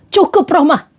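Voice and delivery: mock dramatic "enough"; wrong-way boundary. Shown as a demonstration. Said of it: "Jangan kayak gini". (teguran yang dilebih-lebihkan)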